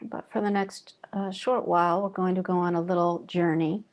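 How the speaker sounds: calm, even meditation voice with long pauses; guided meditation. Real, not acted. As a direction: guide them gently inward, leaving long silences between phrases. Leads guided breathing meditation.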